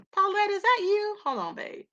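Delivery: ordinary delivery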